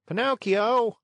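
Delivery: high loud call. A narrator's reading. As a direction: call across to him. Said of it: "Lampwick called out".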